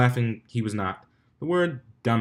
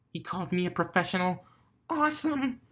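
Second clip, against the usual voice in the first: much higher, giddy; excited; 8 semitones higher, 6 dB quieter than usual. A narrator's reading. Dash thrilled her idol called her a professional. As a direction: squeal with delight inside.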